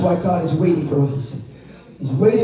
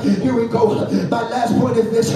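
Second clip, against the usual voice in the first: full-force sermon delivery, driving phrase by phrase; preaching; 6 semitones higher, 5 dB louder than usual. Real, not acted. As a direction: preach hard to the congregation, punching each phrase.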